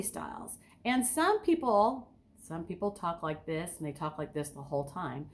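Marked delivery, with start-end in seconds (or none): 2.4-5.3 low, soft drone with no inflection; monotone. Shown as a demonstration. Coach imitating speakers who never vary their voice, monotone. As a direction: imitate a dull speaker, all on one low note. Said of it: "there's no reflection in their voice".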